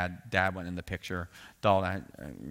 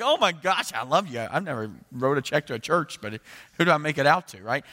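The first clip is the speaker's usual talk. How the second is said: much higher, louder gushing delight; thrilled relief; 7 semitones higher, 8 dB louder than usual. Retold or imitated. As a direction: burst out like you've just been set free. Young self thrilled by permission.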